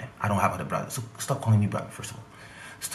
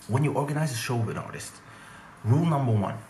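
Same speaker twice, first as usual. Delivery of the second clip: ordinary delivery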